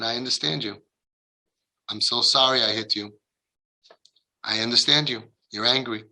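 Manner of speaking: soft, low, gentle acknowledgement; quiet, humble. Retold or imitated. Father apologizing to his angry child, quiet and humble. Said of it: "He just was quiet".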